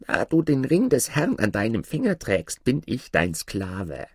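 high-pitched dwarf, formally submissive; servile dwarf spirit. Acted. Dwarf explains the ring's power to Aladin, high servile voice. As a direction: declare service in a high little voice.

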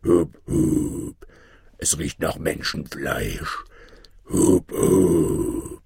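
low ogre voice with hooted hups; man-eating ogre. A narrator's reading. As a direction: hoot and sniff out prey as an ogre. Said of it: "Der Menschenfresser schaute plötzlich auf und rief". (tiefe Stimme eines Menschenfressers)

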